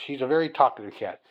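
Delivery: ordinary delivery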